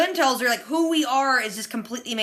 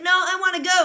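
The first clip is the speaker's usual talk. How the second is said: higher, louder mock kid whine; whiny little boy; 7 semitones higher, 4 dB louder than usual. Little boy protesting to his mom, higher mock whine. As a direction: pipe up like a bratty kid.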